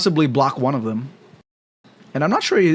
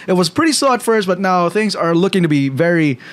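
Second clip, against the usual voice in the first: ordinary talk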